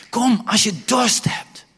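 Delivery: high, loud, slow call. Shown as a demonstration. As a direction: end the build at full shout. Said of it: "repeated in rising force". (luid en langzaam roepen)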